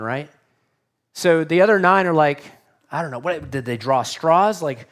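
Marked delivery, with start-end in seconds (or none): none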